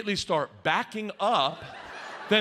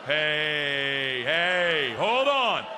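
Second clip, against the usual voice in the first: drawn-out call for attention; 4 semitones lower, much slower than usual. Acted. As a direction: stretch out each hey to stop everyone. Calls out in acted bit.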